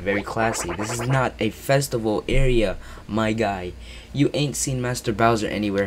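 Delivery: ordinary talk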